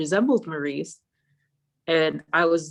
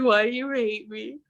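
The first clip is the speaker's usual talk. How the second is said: mock hurt plea; 6 semitones higher than usual. Retold hurt at being snubbed.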